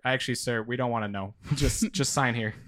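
quiet, giggling mock contractor; barely holding back laughter; laughing through words.

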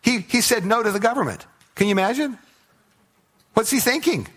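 high-pitched feigned incredulity; mock disbelief. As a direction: pitch it high, pretending to be scandalized. Mock disbelief at refusing the government.

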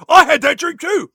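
high loud cockney yell; mock cockney shout. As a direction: shout it like a rowdy cockney girl. Acting Spice Girls banter.

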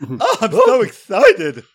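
slow, high squealing cartoon voice; silly excited voice. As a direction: drag it out, gushing. Silly put-on voice continues.

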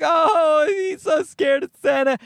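high, cooing mock parent; mocking impression of a laughing parent; amused parents. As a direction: imitate tickled parents in a high voice. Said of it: "They're laughing like".

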